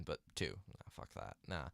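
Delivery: under the breath, nearly whispered; muttered aside. Real, not acted. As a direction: toss it away under your breath.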